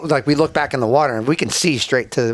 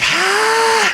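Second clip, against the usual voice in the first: high imitated gasp; catching his breath. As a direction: heave in air like a winded diver. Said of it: "trying to catch his breath".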